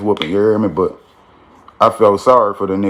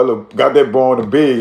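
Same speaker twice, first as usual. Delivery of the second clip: ordinary talk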